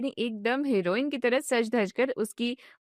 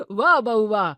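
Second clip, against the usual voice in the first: louder, hearty cheer of wah; loud delighted praise; 6 dB louder than usual. Acted. Father-in-law cheering daughter-in-law's fusion outfit, loud and delighted. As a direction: applaud her cleverness out loud.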